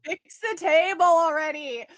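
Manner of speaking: mock-exasperated yell, high and loud; exasperated shout. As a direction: demand it loudly, in playful exasperation. Mock demand about broken table.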